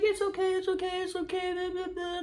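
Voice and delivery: high, mock boy voice brushing her off. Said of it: "He's like".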